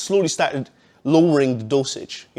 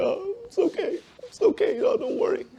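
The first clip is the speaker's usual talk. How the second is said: much higher, soft comforting voice; mock comforting crowd; 5 dB quieter than usual. Imagined onlookers consoling the crying speaker, high mock comfort. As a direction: coo at him like pitying onlookers. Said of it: "everybody would be like".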